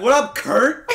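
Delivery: high-pitched, playful call to a teacher; calling across hallway. Retold or imitated. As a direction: holler a cheeky greeting down the hall. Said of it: "Like, across the hallway".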